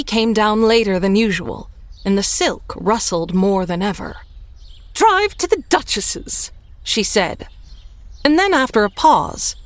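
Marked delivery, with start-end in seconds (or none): 4.9-6.5 high, slow haughty command; grand lady ordering her coachman; imperious. A narrator's reading.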